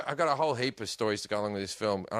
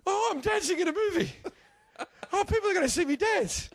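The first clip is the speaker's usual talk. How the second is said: very high, wailing dread; hammed-up nightmare panic, pitched way up; mock waking panic. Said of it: "I've woken up with".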